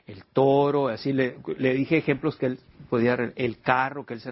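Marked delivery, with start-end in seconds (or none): 0.0-0.9 slow, drawn-out el caballo; teaching slowly; slow example word. Shown as a demonstration. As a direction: stretch out caballo slowly for the class. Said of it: "Así como cuando termina algo con o".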